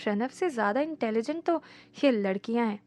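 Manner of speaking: soft, low private musing; quiet grumbling thought; inner thought. A narrator's reading. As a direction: think it to yourself, hushed. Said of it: "मन ही मन सोचा".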